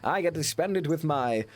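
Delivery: grand, self-satisfied gentleman; hammed-up pompous Englishman. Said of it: "doing my [name] impression".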